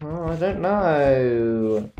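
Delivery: very slow, drawn-out whine sliding down; weary moan. Real, not acted. Gamer stuck hunting for gems, weary moan.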